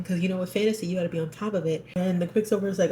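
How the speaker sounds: usual speaking voice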